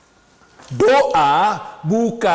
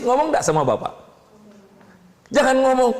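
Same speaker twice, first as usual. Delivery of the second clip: ordinary talk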